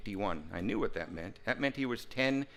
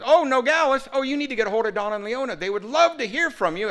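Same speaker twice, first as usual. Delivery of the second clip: much higher and louder, mother delighted; excited mom; 13 semitones higher, 8 dB louder than usual. Retold or imitated. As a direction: gush like an eager mother. Mother voiced on phone call.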